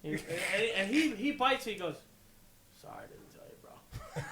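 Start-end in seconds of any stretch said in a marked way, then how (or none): 2.8-4.2 whispered, very soft reply; hushed mock apology. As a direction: apologise under your breath, imitating a friend. Retold friend plays along, apologising.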